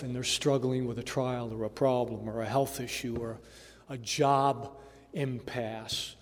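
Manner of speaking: normal talking voice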